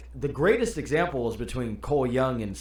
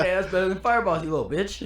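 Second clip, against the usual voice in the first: quick, lightly higher attempt at Kano's accent; Kano's accent, badly faked; 5 semitones higher, faster than usual. Correcting the movie quote, mild taunt in Kano's accent. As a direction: toss off the taunt in a half-hearted Kano accent. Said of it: "I can't do an accent".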